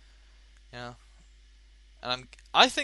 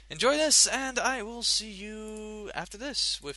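radio DJ; 4 semitones higher than usual. Acted. Radio host song intro.

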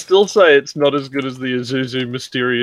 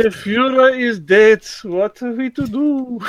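hammed-up German accent, pitched higher; a deliberately awful German accent, raised high; mock German accent, much higher and theatrical; bad German accent; 11 semitones higher than usual. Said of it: "That was the worst German accent I've ever heard".

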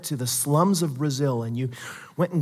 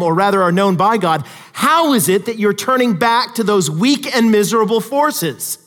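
loud, rising, fervent sermon delivery; impassioned preaching; 5 semitones higher, 5 dB louder than usual. Acted. Preacher pleading with the congregation, loud and fervent. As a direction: drive Paul's rebuke home from the pulpit. Preaching Paul's words at full force.

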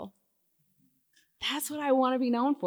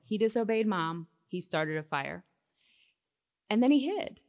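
ordinary delivery

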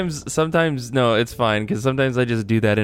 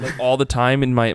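ordinary delivery

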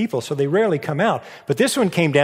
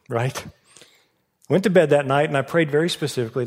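ordinary talk, nothing marked

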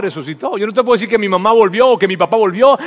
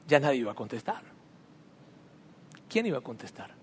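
hushed, much softer, breaking with sorrow; almost a whisper, heavy with loss; quiet grief; 5 semitones lower, 10 dB quieter than usual. Real, not acted. Remembering calling his dead parents, softly grieving.

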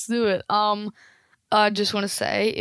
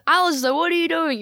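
higher, scolding mum voice; mother's line demo; 7 semitones higher than usual. Shown as a demonstration. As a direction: show the mother calling out. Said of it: "You just".

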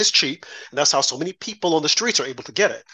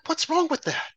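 incredulous; 12 semitones higher, 5 dB quieter than usual. Retold or imitated. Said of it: "And I'm like".

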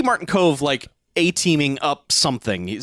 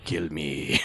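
much lower, soft put-on voice; mock villain dare; 12 semitones lower, 10 dB quieter than usual. Creator invites the android to attack, low mock dare. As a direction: dare them in a low, quiet voice.

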